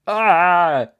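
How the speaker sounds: sudden loud high cry of alarm; alarmed yell. A narrator's reading. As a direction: cry out as the car drops. Izuku rushing to save passengers.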